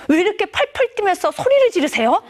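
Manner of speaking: acted teenage indignation without shouting; cheeky teen retort. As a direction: challenge dad like an affronted daughter. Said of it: "에린이 한술 더 떠요".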